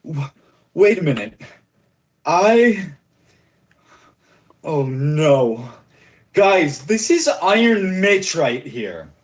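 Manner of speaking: pirate recognizing Iron Mitch, alarmed. Acted. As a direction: realize who you face and warn the crew. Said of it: "eyes and says".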